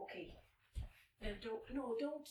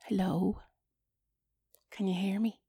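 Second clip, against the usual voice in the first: ordinary talk, nothing marked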